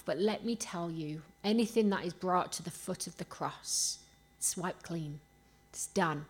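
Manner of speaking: normal talking voice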